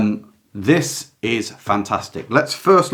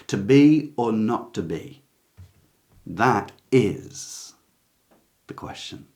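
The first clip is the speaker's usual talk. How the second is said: IS held long, a pause, then a hushed "the question"; stress on IS; slower than usual. Shown as a demonstration. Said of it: "iambic pentameter would suggest is, is where you put the stress".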